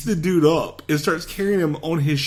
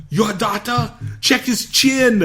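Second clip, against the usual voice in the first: mock question; 9 semitones higher, slower than usual. [name] mocking the doctor.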